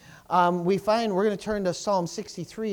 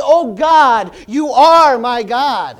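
very loud cry to God; 3 semitones higher, 14 dB louder, much slower than usual. Acted. Psalmist crying out to God, loud. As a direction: shout the verse out to God. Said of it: "No, he's crying out".

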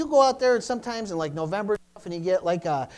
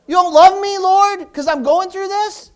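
high, loud anguished plea; desperate complaint to God; 10 semitones higher, 9 dB louder than usual. Retold or imitated. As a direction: cry out feeling abandoned by God. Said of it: "You ever gone to God like that?".

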